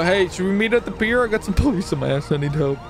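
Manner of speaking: ordinary talk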